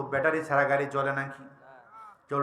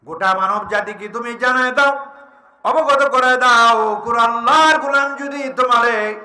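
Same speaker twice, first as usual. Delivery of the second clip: raised, forceful proclamation; full-force preaching; 8 semitones higher, 8 dB louder than usual. Acted. Preacher voicing God's command to the Prophet, proclaimed.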